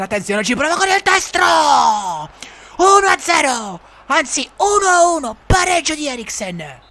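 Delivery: high, loud drawn-out goal shout; breathless italian goal commentary. Acted.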